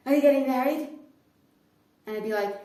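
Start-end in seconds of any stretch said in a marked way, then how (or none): 0.1-0.9 insistent question. Retold or imitated. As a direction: blurt the proposal question. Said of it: "come up to me and go".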